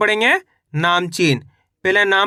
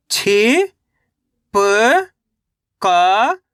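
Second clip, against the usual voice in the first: each syllable held long, separated by pauses; much slower than usual. Shown as a demonstration. Teacher sounding out a word for reading learners, syllable by syllable. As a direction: sound out each letter slowly, stretching it. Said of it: "एक एक अक्षर का उच्चारण".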